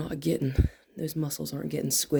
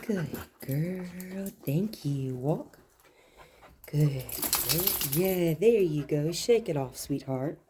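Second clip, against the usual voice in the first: praising the dog; slower than usual. Real, not acted.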